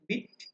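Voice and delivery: বিট said with a brief i, as in "bit"; short i. Shown as a demonstration. Teacher repeats the vowel contrast, short-i বিট. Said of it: "উচ্চারণটা হবে short ই".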